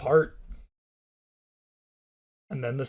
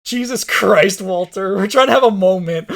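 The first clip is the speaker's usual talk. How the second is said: exasperated; 5 semitones higher, 9 dB louder than usual. Real, not acted. Friend interrupts a tender moment.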